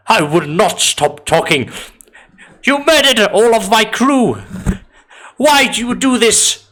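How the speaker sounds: high, outraged shouting woman; defiant high shouting. Acted. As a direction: shout back at the captor, indignant. Captive woman defies her captor.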